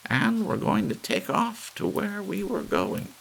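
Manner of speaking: put-on firm old lady who won't be swayed; determined old lady. A narrator's reading. Missus Bellows continues.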